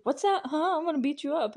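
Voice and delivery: high-pitched confrontational challenge; mock threat. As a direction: imitate someone picking a fight. Retelling Derek's threatening challenge.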